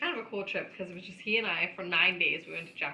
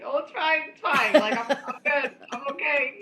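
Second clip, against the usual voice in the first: louder, higher, laughing it's fine; flustered reassuring; 7 semitones higher, 9 dB louder than usual. Retold or imitated. Dad jumping up after the horse laid on him, laughing it off. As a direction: brush it off with a shaky laugh. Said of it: "Dad jumps up like".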